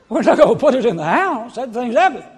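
laughing refusal. Retold or imitated. Husband refusing wife's plant indoors, loud laughing scoff. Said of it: "I said".

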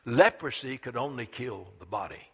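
normal talking voice

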